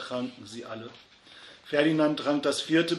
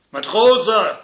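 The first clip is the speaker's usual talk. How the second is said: very loud, high-pitched shout; bellowed summons; landlord's yell; 7 semitones higher, 13 dB louder than usual. A narrator's reading. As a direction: yell it at a drunk customer. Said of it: "schrie ihn der Wirt an".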